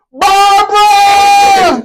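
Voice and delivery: high, slow, loud mock yell; drawn-out, very high imitated shout. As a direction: wail it like the end of the record. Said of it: "that's what he yelled at at the end".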